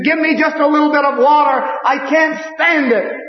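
high, loud anguished begging; crying out for water. Retold or imitated. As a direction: cry out from the flames. Damned man begging God.

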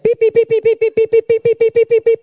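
very high, loud scolding beeps; angry bird chirping. Retold or imitated. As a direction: chirp furiously like a bird yelling at someone. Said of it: "He was, like, shouting at him".

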